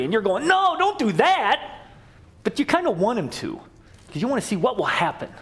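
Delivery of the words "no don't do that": higher, slowed plea to the screen; calling out at the screen. Acted. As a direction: shout a warning at the movie.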